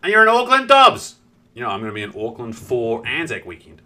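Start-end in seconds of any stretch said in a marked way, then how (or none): 0.0-1.1 high, loud burst of delight; loud and high, pleasantly surprised; excited exclamation. Real, not acted. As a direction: exclaim it, thrilled at the news. Delighted by viewer's location.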